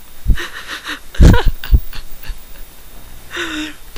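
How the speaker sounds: long high helpless laugh; real laughter. Real, not acted. Reader breaking down after the moaning line, long high laugh. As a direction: let the laughter take over.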